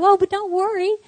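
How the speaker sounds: high, loud, light-hearted mock reassurance; breezy reassurance. Church lady telling panicking mother not to worry, breezy reassurance. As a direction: imitate a caller brushing it all off.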